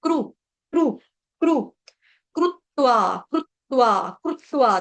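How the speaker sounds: कृत्वा said as "krutva", ऋ as "ru" not "ri"; pure Sanskrit kru. Shown as a demonstration. Teacher drills pure Sanskrit pronunciation of कृत्वा for students. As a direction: say क्रू with a rounded u, then कृत्वा as krutva. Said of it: "शुद्ध उच्चारण होता है वो क्रू".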